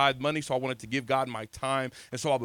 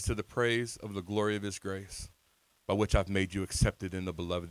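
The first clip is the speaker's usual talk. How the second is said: soft scripture reading; 7 semitones lower, 6 dB quieter than usual. Real, not acted. Reads scripture softly.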